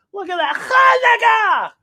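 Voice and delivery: high, slow stretched shout; cheer. Acted. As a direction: celebrate out loud. Festive shout.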